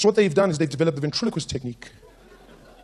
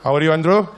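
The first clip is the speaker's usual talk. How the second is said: greeting Andrew again, louder; corrected greeting to invisible friend, louder; louder redo; 7 dB louder than usual. Shown as a demonstration. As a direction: say "How are you, Andrew?" again with a louder push. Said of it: "No, he's left-handed".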